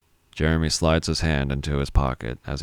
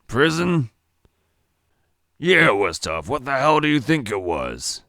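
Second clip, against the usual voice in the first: irritated; 10 semitones higher, 4 dB louder than usual. Acted. Joey bristles at the question.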